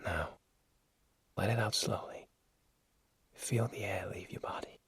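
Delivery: breathy unvoiced whisper. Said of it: "he said in a whisper".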